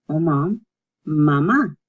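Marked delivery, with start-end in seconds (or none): 1.1-1.7 "mamá" with the second ma stressed; stress on last syllable. Shown as a demonstration. Teacher showing the accent mark, "mamá" stressed on the end. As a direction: lean on the final á in "mamá". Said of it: "There's emphasis on the last".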